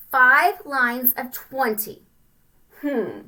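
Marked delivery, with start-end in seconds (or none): none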